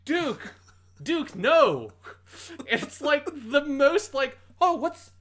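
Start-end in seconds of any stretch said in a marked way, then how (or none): 0.0-1.9 high, loud hammy shout; mock anguished cry. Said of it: "and Kai goes". 4.6-5.1 high, loud, casually puzzled; underplayed mock surprise. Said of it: "most underplayed reaction".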